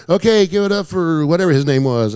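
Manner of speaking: imitated stage host; club MC. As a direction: hype up the room like an emcee. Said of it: "The M C goes".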